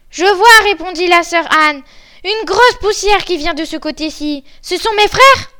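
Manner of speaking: high, loud announcement; excited lookout. Acted. Sister Anne reports from the tower to the wife, excited and loud. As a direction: call down news of a sighting. Sister spots dust, hoping for rescue.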